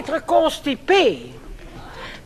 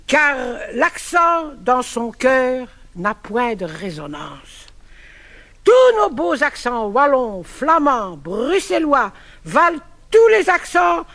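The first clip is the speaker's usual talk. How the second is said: poem recital; 4 semitones lower than usual. Acted. Recites verse pastiche on accent.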